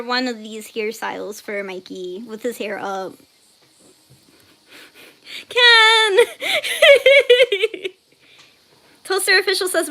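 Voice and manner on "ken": high, loud cry dissolving into laughter; mock scolding. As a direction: yell the name in amused shock. Reacting to Ken's message.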